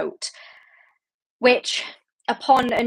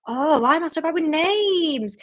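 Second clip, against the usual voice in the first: self-exasperated lament; 7 semitones higher than usual. Real, not acted. Host annoyed at forgetting a character's name, exasperated lament. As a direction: lament it higher, stretching the last word.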